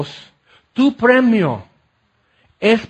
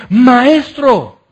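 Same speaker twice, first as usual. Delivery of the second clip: joyful recognition; 6 semitones higher, 7 dB louder than usual. Retold or imitated. Mary recognizes Jesus.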